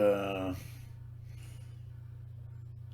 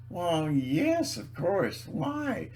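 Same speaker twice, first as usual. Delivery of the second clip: higher, softer puzzled reply; softly acted surprised woman; imitated delivery woman; 6 semitones higher, 5 dB quieter, much slower than usual. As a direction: mimic her lightly, lifting high on "Why". Voices delivery woman in story.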